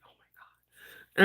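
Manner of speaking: whispered oh my God; whispered groan. Retold or imitated. Hope groaning at the grocery bags, whispered. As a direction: breathe it out unvoiced, slow. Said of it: "She's like".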